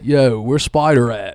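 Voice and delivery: put-on slow, drawn-out Baltimore accent. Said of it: "this is my Baltimore accent".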